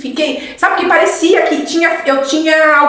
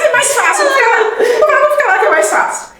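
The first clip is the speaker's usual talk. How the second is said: higher-pitched, laughing admission; amused confession; 10 semitones higher than usual. Real, not acted. Joking to friends about taking the easy way, amused and laughing. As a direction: laugh through it, voice lifted high.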